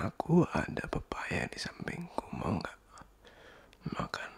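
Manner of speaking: teasing playful whisper; flirty whisper. Acted. Flirty ASMR roleplay offering papaya.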